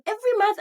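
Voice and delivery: "Every month" high and loud; emphatic correction. Real, not acted. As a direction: correct yourself firmly. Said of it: "not almost every month".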